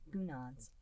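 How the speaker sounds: ordinary delivery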